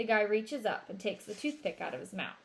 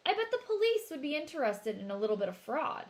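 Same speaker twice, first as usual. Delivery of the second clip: veiled threat; 6 semitones higher, 3 dB louder, faster than usual. A narrator's reading. Travis threatens shopkeeper.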